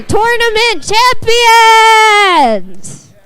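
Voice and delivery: slow, drawn-out champion shout; big announcer shout. Acted. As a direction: stretch out champions like a ring announcer. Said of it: "let 'em celebrate".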